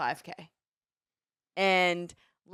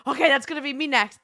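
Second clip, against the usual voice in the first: raised, louder burst of resolve; pumped-up self-talk; 6 semitones higher, 5 dB louder than usual. Retold or imitated. Racer recalling being spurred on by encouragement, pumped up. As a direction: psych yourself up out loud. Acting out her pumped-up thought.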